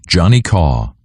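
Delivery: usual speaking voice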